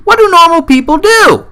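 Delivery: high, loud and baffled; shouted in high disbelief; bewildered shout. Acted. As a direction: yell it like it makes no sense. Mock bewildered rant.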